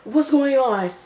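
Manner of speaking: high, loud frantic questioning; freaking out. Retold or imitated. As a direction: retell a man losing it, pitch way up. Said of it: "he's freaking out".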